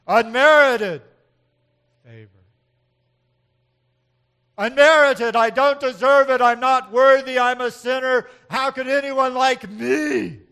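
shouted, high and pleading; loud, high self-abasing cry. Shown as a demonstration. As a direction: cry it out like an unworthy sinner. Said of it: "the way we often talk about it is this".

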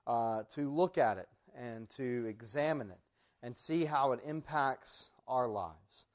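low, quiet, unhurried opening prayer; bow the head and pray softly to God. Acted. Preacher opens the evening class in quiet prayer.

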